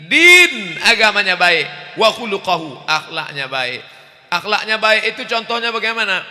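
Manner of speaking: loud, rising preacher's cry; loud sermon delivery; forceful preaching. Real, not acted. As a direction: preach it out to the crowd.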